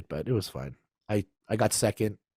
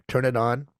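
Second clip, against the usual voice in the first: ordinary talk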